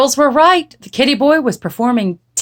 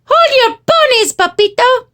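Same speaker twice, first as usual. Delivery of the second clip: high, squeaky Spanish-flavored voice; little tiny dog; 5 dB louder than usual. A narrator's reading. Smallest dog halting Skipito, high little voice. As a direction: declare it as the smallest pup. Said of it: "declared Poquito Tito, the smallest of the small ones".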